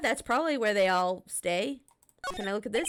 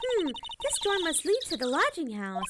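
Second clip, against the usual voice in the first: put-on higher, bubbly teenage girl voice, teasing; Maya character voice; 8 semitones higher than usual. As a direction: play the cheeky girl sidekick, pitched up. Voicing Maya again.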